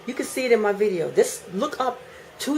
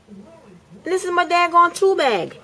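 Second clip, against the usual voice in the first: exasperated; 10 semitones higher, 5 dB louder, slower than usual. Real, not acted. Exasperated point about tool bag.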